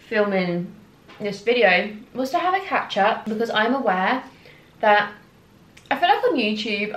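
speaking between bites; talking while chewing. Real, not acted. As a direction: mouth partly full of food. Seen 1: puts fry in mouth ~1.5s, chews at 2.0s, keeps handling fries.

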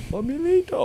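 very high comic wail; mock lament. Acted wail after relief, comic.

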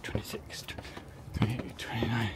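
counting steps in a whisper. Real, not acted. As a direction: breathe the numbers under your breath. Counting steps under breath.

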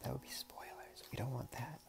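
whispered aside. Real, not acted. Vlogger whispering a spoiler aside to viewers. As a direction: drop to a secret whisper.

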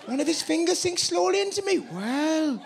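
high, lilting Welsh; Welsh accent. Shown as a demonstration. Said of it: "Welsh, no problem".

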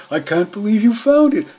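very high, very loud delighted dog voice; grateful dog thanking the detectives, very high and loud; overjoyed. A narrator's reading. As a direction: burst out in disbelief and joy. Dog overjoyed at finding collar.

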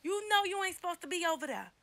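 high, loud playful scolding; goofy mock call-out. Vlogger pretends to catch Ken cheating on video, goofy loud scolding. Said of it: "I'd be like".

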